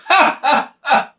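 a high scornful burst of laughter; high mocking laugh at the prisoner. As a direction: laugh down at him like a guard. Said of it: "פתאום הוא שומע צחוק".

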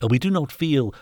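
a touch of nervous hesitation; stuttering. A narrator's reading. Master timidly objecting to the knight, lightly flustered. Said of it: "stuttered the master".

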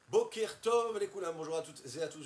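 loud, high, fast, beaming hello; excited greeting. Real, not acted. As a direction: burst in with joy. Enthusiastic greeting opening the show.

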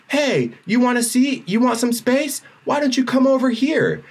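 high-pitched mock hail across the rows; retold calling out. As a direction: imitate someone calling over, pitch way up. Man calls from four rows down.

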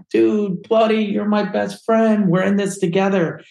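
high-pitched mock-tearful pleading; retold crying plea.

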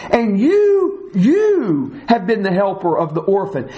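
emphatic you at the start; stress on you. Shown as a demonstration. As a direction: punch you, then repeat it. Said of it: "both of those yous are emphatic".

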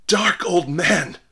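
terrified minister cries out at the veiled man; frightened exclamation. A narrator's reading. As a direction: recoil in terror as you say it. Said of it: "exclaimed the affrighted minister".

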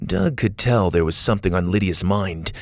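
plain reading voice

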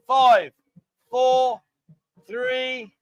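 countdown call. Real, not acted. Counting down a drinking challenge.

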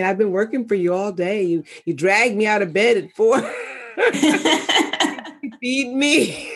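lightly voiced tired body, laughing into it; voicing the body. Body complaining to its owner, mock weary voice. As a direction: speak as your own worn-out body, half joking. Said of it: "the body's saying".

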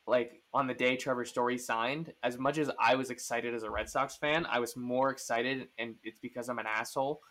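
usual speaking voice

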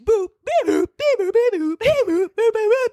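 high robot beeps; robot binary. Pretend. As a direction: beep like a computer. Song called [name].

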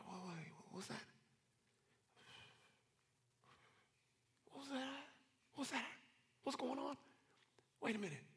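very soft, high-pitched wondering; hushed startled listening. Acted. Prophet hearing a little whisper, hushed puzzlement. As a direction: barely voice it, straining to catch a faint sound. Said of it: "little whisper".

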